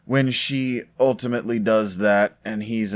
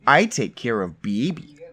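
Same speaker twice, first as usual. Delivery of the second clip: lightly mimicked Midwest accent; bad Midwest accent; 2 semitones higher than usual. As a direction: imitate her off-key Midwest accent. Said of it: "like, a bad Midwest thing going on".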